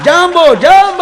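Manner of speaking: loud, high jambo call; mock greeting shout. African guest shouting a greeting, hammed-up. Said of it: "આફ્રિકાના આવેલા".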